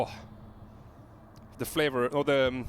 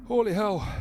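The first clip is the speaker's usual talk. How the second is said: high, loud real exclamation; real outburst; 8 semitones higher, 5 dB louder than usual. Real, not acted. Taster yells at the habanero heat, high loud outburst. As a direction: blurt it out as the heat hits.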